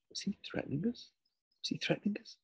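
high, soft, rising question; hushed alarm. Acted. Startled onlookers wondering at a threat, hushed high question. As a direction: ask it under your voice, pitched right up.

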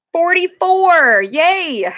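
high, stretched-out cheer; excited. Real, not acted. Seller pleased at a bigger size, excited cheer. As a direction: announce it big, drawn out and pleased.

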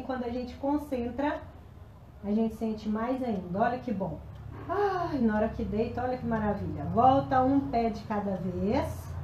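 soft, relaxed stretching guidance; quiet, soothing instructor; calm cool-down voice. Acted. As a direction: ease them down gently after the workout.

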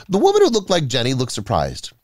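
ordinary delivery

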